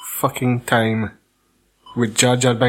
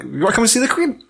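friendly Jedi; 7 semitones higher, faster than usual. Acted. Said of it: "Instead, he's like".

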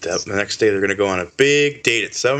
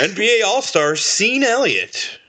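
sports announcer; 10 semitones higher than usual. Acted. Mock sports announcer intro.

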